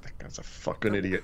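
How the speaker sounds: unvoiced, soft imitated mutter under the breath; muttered insult. Pub guys walking off from fake Scotsman, muttered whisper. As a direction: mutter it as a guy walking away. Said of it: "walked off like".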